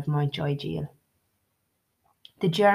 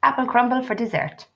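trying to sound upbeat; 7 semitones higher than usual. Acted. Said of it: "trying to sound upbeat".

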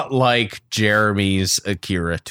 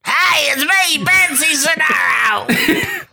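shrieking high gremlin voice; Betsy Sodaro; slower than usual. Acted. Guest impersonator crashes the improv show, in a piercing gremlin shout. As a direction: screech it out like a gremlin, drawn out at the end. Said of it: "like, a gremlin voice".

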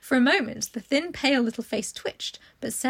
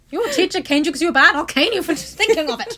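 loud, raised mock threat from a strict teacher; mock stern teacher; 6 semitones higher, 8 dB louder than usual. Teacher threatening a pupil she canes: loud mock scold. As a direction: bark it like a Victorian schoolmistress.

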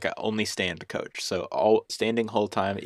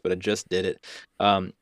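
usual speaking voice

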